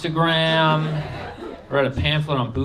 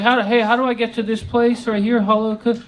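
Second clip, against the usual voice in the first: higher, louder, lost tourist asking the way; clueless tourist voice; 10 semitones higher, 4 dB louder than usual. Retold or imitated. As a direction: play the lost tourist, chirpy and unsure. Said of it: "I'm like".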